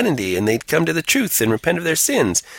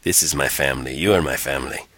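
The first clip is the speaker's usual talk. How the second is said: cult leader; 10 semitones lower than usual. Retold or imitated. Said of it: "He sounds like a crazy cult leader".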